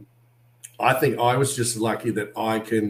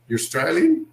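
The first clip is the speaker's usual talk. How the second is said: surprised fan; 6 semitones higher, 3 dB louder than usual. Retold or imitated. Fans surprised by the accent.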